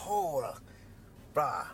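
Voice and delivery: usual speaking voice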